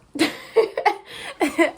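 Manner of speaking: breathy burst of laughter; airy laugh. Real, not acted. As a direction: laugh it out, almost silent. Laugh after mock quote.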